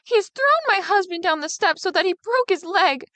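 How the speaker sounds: loud screams. Acted. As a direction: scream the news at the father. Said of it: "with loud screams".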